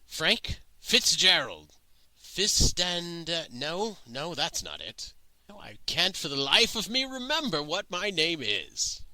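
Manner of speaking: put-on halting old man groping for his own name; befuddled old man, struggling. Old traveler asked his name by the fire, confused and halting. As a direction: start unsure, then rise into frustrated bewilderment.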